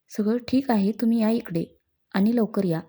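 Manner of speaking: ordinary delivery, nothing marked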